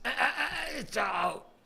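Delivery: shaky high politician impression; RFK impression. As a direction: stammer out a strained RFK voice. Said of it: "is that your R F K?".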